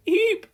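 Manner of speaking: frightened high-pitched eep; a sudden startled squeal; loud panicked eep of fright; high squeak. Acted. Girl squeaks as robot falls.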